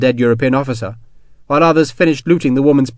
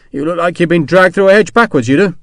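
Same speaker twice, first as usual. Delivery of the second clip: Sharp's cockney voice; 5 semitones higher, 4 dB louder than usual. Acted. Sharp teases the shaken woman.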